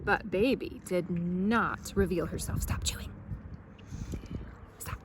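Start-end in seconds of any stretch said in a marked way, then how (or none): none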